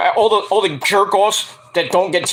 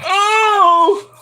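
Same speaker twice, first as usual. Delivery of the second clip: mock pained yelp; 14 semitones higher than usual. Cora reacting to Natalya's slap, high yelp. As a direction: yelp as if just slapped. Wrestler gets slapped.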